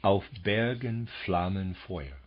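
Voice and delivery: slow German line, each second syllable stressed; iambic verse recited. Shown as a demonstration. Lecturer recites German verse to students, slow iambic lilt. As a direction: lean forward from the weak beat into the strong one. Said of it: "Here we have an unemphasized syllable".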